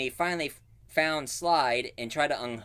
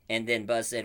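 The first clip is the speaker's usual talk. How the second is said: ordinary delivery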